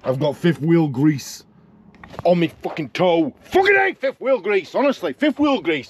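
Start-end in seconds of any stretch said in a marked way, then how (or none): 3.4-5.9 real annoyed outburst; furious outburst. Real, not acted. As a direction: spit out hatred of the grease. Ranting about grease.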